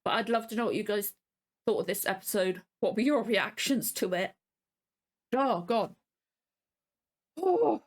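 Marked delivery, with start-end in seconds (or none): 5.3-7.8 emotional sighing oh; choked-up little gasps; overcome gasp. Real, not acted. Vlogger overwhelmed after the episode, choked up. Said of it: "I'm emotional".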